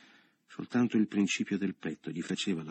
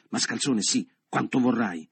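higher, louder comeback, slowing at the end; defiant retort; 4 semitones higher, 6 dB louder than usual. A narrator's reading. Leo retorts while pushing Michele, defiant. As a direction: throw the insult back forcefully.

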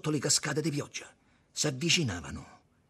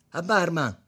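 high, loud call; 6 semitones higher, 8 dB louder than usual. A narrator's reading. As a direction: hail the barman. Malandro chiama il barista. (richiamo al barista a voce alta)

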